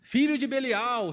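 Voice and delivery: high, loud hammed-up cursing; mock insult. Preacher imitating a driver cursing whoever cut him off, high mock shout. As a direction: play the hothead swearing at a driver. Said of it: "vai falar".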